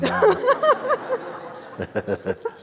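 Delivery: a high, long burst of real laughter; high laughing fit. Real, not acted. Teacher laughing along with the laughing student, high laughing fit.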